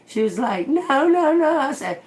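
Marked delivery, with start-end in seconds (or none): 0.7-1.6 mock panicked no. Girl begging not to be hit.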